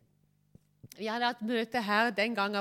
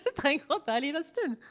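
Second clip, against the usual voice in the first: laughing; 6 semitones higher, 5 dB louder, much faster than usual. Real, not acted. Laughs about feeling high after meetings.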